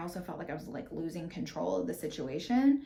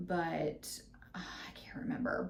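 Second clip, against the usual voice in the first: whispered aside; 2 semitones lower, 5 dB quieter than usual. Real, not acted. Muttered aside while recalling dates.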